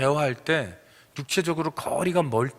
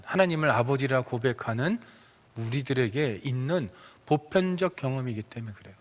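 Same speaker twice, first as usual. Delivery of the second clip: usual speaking voice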